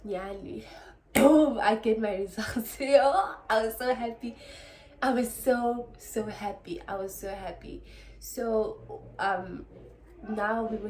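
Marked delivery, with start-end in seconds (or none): 1.1-6.8 excited joy. Real, not acted. Joy on getting results.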